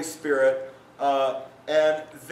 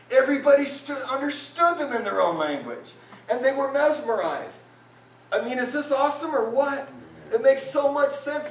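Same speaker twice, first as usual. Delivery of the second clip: higher, rising excited sermon voice; fired-up preaching; 8 semitones higher, 4 dB louder than usual. Acted. Pastor rousing the congregation, fired-up preaching. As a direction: preach it with mounting joy.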